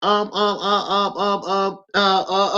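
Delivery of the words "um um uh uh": ordinary talk, nothing marked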